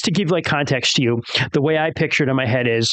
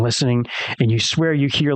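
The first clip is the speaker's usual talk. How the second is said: ordinary talk, nothing marked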